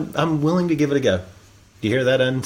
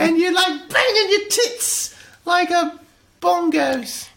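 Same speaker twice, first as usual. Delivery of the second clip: very high, drawn-out mock-British squeak; hammed-up British lady in falsetto, savoring each phrase; Missus H; 14 semitones higher, slower than usual. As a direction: stretch it out in a piping British accent. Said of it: "I do better if I'm imitating Missus H".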